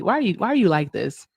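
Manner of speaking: ordinary talk, nothing marked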